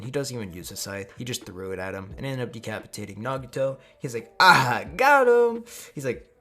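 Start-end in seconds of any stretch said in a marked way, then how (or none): 4.4-5.6 high, loud, stretched gloating; hammed-up victory cry; triumphant whoop. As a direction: crow over a lucky hit. Acting triumph after a kill.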